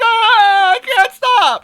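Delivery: very high, loud cry; mock desperate wail. Boyfriend answering scolding girlfriend, high desperate mock wail. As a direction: wail helplessly, hammed up.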